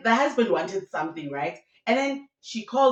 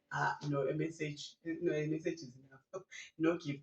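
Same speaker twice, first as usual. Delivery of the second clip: muted dismissal; 5 semitones lower, 11 dB quieter than usual. Acted. Acts out dismissing need for gift.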